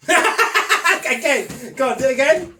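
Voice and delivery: shouting through laughter; loud laughing demand. Real, not acted. Laughing, urging kid to repeat.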